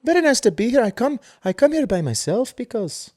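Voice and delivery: high-pitched imitation of an older-style accented lady; [name] accent. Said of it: "Did she have an accent like that?".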